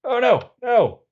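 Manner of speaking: sudden high, loud alarm. Real, not acted. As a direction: catch a mistake in a panic. Realizes a crafting mistake.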